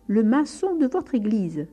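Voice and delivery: loud repeat, maçon hit hard; shouting again. A narrator's reading. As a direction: repeat it loudly for the deaf priest. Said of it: "Il fallait lui crier tout cela dans le tympan".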